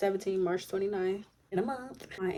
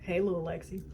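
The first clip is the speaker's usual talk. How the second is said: a lightly put-on voice of her ex; a hint of her ex's voice; imitating Virgil; 2 semitones lower than usual. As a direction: try on his laid-back greeting. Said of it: "let me try to do his voice".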